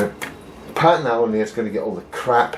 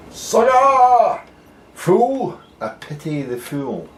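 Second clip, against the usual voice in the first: mock tough guy with a Scottish accent; tough-guy catchphrase impression; 12 semitones higher, slower than usual. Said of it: "Doesn't sound the same in a Scottish accent".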